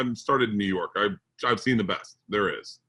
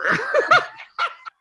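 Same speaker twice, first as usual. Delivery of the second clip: real high-pitched cackling laugh; big high laugh; 4 dB louder than usual. Real, not acted. Storyteller cracking up after his punchline, high laughter. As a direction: burst out laughing, high and helpless.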